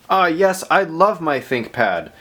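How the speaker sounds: usual speaking voice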